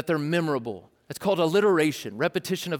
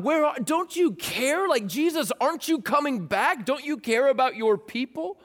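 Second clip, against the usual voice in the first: higher, pleading questions; persecuted believers begging God, pleading; pleading cry; 7 semitones higher than usual. Pretend. People crying out to God.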